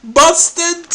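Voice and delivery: high, loud cry broken by laughs; gloating laughter. Real, not acted. Host gloats over catching his opponent, laughing. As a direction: crow it through real laughter.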